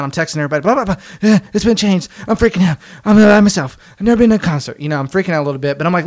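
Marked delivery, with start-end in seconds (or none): 0.6-4.8 mock panic, higher and louder; retold freak-out. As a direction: act out frantic texting in a raised voice. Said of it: "I'm, like, freaking out".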